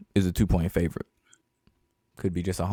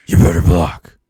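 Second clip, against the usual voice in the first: lightly growled Batman-style command; a hint of Batman barking at linemen; 5 semitones lower, 8 dB louder than usual. Teasing friend voices Brady as Batman, a hint of growl. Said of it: "He gotta talk like Batman".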